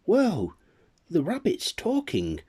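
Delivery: high, loud whoa; astonished child. Acted. A village child seeing the rabbit speak, astonished. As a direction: gasp in amazement.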